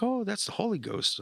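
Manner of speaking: high-pitched "oh" of recognition; retold knowing exclamation. Pastor explains the strange tongue to new believer, high exclamation. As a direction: imitate a pastor recognising it at once. Pastor's reply acted in retelling.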